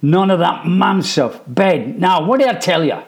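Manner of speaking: loud, high no-nonsense scolding; stern scolding. A narrator's reading. Mum cuts off the boys' pleas, loud and stern. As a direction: cut their excuses short.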